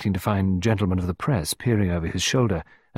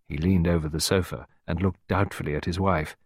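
ordinary delivery

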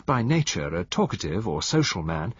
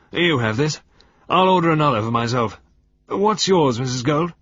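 hearty host; 6 semitones higher, 7 dB louder than usual. A narrator's reading. Chantry offering drinks.

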